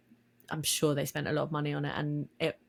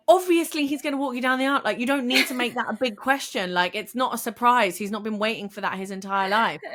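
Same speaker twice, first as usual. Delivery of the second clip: exasperated; 6 semitones higher, 4 dB louder than usual. Retold or imitated. Acts out exasperated reaction to show.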